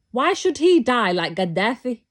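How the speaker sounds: normal talking voice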